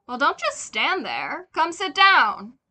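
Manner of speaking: loud, slow fatherly call; father's voice. Acted. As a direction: call the boy over, loud. Father voiced calling son.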